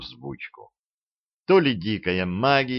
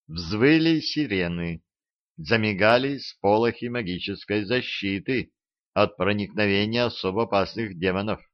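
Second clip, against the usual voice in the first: usual speaking voice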